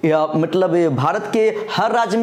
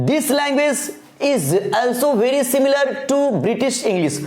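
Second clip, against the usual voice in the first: slow dictation; 3 semitones higher, much slower than usual. Acted. Reads the note slowly.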